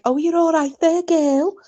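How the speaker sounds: high, cheerful chatty voice; high cheerful voice for a friendly Alexa; chatty local Alexa. Retold or imitated. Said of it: "says something like".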